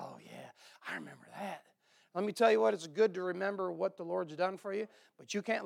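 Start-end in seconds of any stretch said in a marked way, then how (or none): 0.0-1.6 very low, soft creak of a reply; low recollection. Acted. David recalls the sword for Ahimelech, low murmur. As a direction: drop right down, as if it dawns on you.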